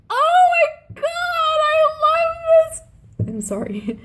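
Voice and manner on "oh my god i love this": high sung squeal of pure delight; squealing heart. Pretend. Voicing her own heart over Sam's loyalty, high squealing delight. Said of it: "my heart was like".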